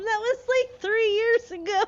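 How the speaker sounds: cracking up, high and loud; slowed, breaking into laughter; laughing. Real, not acted. Streamer laughing about her husband's old slang, cracking up.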